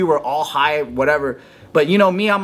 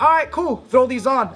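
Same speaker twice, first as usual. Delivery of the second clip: much higher, cocky teasing voice; mock bully; 10 semitones higher than usual. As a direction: toss it off like a smug older kid. Bully mocking him, voiced.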